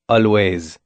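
put-on light French accent, smiling; a hint of a charming French accent. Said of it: "Thomas said in his French accent".

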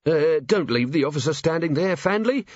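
nervous voice. A narrator's reading. A nervous man tells his workman to admit the officer, nervously. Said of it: "said a nervous voice".

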